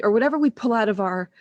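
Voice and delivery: normal talking voice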